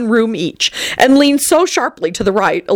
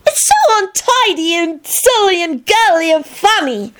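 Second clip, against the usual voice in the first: put-on excited young boy; 11 semitones higher than usual. A narrator's reading. Teddy gushing at the room.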